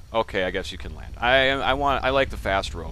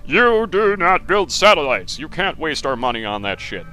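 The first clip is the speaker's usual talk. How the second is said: hammed-up officer lecturing about wasted money; scolding officer; 7 semitones higher, 8 dB louder than usual. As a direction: play the stern commander telling him off. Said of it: "scold me and chastise me".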